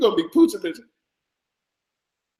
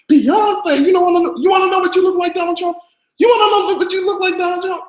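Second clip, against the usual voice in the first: shrieking taunt; 14 semitones higher than usual. Real, not acted. Roasting Trump with a shrieking taunt.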